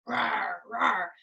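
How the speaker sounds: playful mock roar; hammed-up roar; lion roar. Shown as a demonstration. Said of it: "What sound do lions make".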